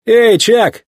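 high, sharp call to a mechanic. A narrator's reading. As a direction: hail someone across the pit. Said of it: "окликнул Маккуин одного из механиков".

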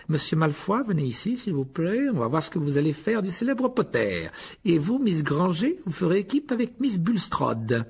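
high, measured, mock-polite voice; sneering teacher. A narrator's reading. Rogue pairs Harry with Malfoy, mock-polite and sneering. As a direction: assign partners with cold relish.

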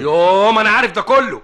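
loud, high fed-up cry; exasperated outburst. Acted. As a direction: snap back, fed up.

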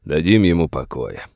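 usual speaking voice

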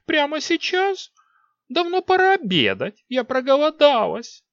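put-on very high, loud whining girl; spoiled queen whining about lunch; whiny young queen. A narrator's reading. Queen complains.

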